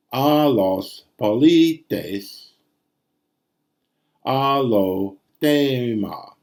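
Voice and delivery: A los polites read slowly, linking the words; slow demo reading. Shown as a demonstration. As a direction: spell out A lo tema slowly across the word break. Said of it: "may belong to the next word".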